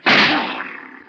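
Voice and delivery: a sudden high burst of laughter; sharp laugh. Acted. Slim laughing at Duke's missed shot, high laughing burst. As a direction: laugh out loud at the miss.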